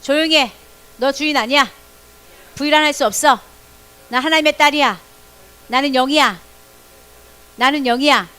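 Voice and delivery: loud, firm declaration. Shown as a demonstration. Pastor leads the children against anxiety, loud declaration. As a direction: proclaim it boldly and loudly. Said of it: "시작".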